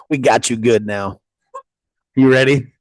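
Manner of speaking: ordinary delivery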